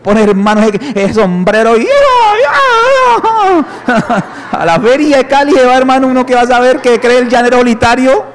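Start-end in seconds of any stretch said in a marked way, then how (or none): 1.9-4.5 long laugh squealing up high; high wailing laugh. Real, not acted. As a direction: let a shrill laugh ring out.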